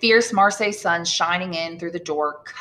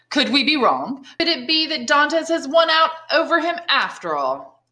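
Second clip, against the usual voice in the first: voiced Danglars; 9 semitones higher, 4 dB louder than usual.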